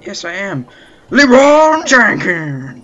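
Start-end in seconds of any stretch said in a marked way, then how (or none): 1.1-2.8 slow, high shout of the name; stretched battle cry. Pretend. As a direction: yell it out long and loud, charging in. Battle cry while playing.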